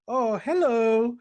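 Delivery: slow, loud, high mock delight; intrigued greeting. Washu looks in the boxer briefs, intrigued greeting. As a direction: draw it out as an impressed hello.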